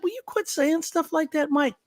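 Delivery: pleading rebuke. Retold or imitated. Speaker begging Mike to stop talking like that, high pleading. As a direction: beg him to stop, voice up high. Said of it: "I was like".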